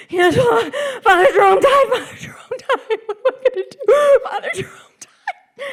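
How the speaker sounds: high, loud, sobbing panic; bawling. Retold or imitated. Grandma on the phone at dawn, bawling in panic. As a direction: wail it like your priest just died. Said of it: "She's bawling".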